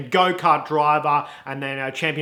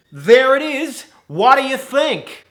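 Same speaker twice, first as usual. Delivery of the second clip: high, loud, patchy American manager; wavering American accent; 9 semitones higher, 7 dB louder than usual. Acted. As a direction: proudly reveal it in unsteady mock American. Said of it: "His accent goes in and out".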